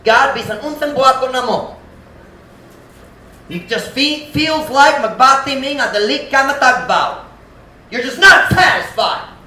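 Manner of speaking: high, loud frustrated grumble; frustrated complaint. Acted. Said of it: "kinda get that attitude".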